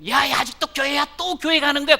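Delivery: mother nagging son for being at church again, loud and high; scolding mother. Retold or imitated. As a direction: nag him sharply.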